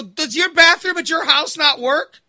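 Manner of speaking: high, loud incredulous demand; exasperated yell. Retold or imitated. Son confronting mother about the bathroom, high loud outrage.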